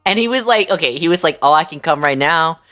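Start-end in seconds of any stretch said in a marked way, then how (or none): none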